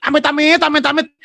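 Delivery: high, loud amit-amit, mock disgust; a comic heaven forbid, high and loud; Semarang-style grumble. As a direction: play a fed-up man from Semarang. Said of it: "Kalau Jim Murphy orang Semarang".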